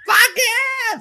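excited shout. Real, not acted. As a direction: cheer it at the top of your lungs. Excited outburst about VR.

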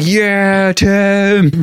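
high, mock-excited cheer for Tim; sarcastic fan cheer, pitched high. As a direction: cheer his name like a proud fan.

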